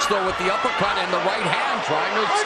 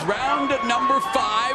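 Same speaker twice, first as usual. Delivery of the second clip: ring announcer call; 6 semitones higher, much slower than usual. Acted. Announcing the new round.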